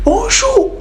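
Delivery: very high squealed greeting; mock girl's reply. Frowning girl answering the suitor, hammed-up squeal. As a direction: squeal it like a comic, exaggerated reply. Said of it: "Après, elle fait, elle fait".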